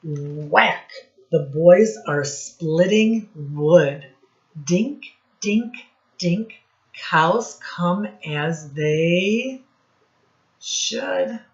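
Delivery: lively read-aloud, sound words popped out; reading to children. Acted. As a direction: read it to a class of little kids, playing up the noises. Teacher reads rhyming picture book.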